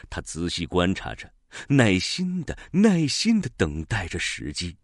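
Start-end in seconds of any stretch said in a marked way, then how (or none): none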